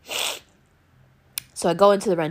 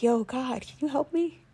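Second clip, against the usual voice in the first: a soft, small pleading voice; a little inner voice asking God for help; 5 semitones higher, 4 dB quieter than usual. Retold or imitated. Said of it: "was this little voice".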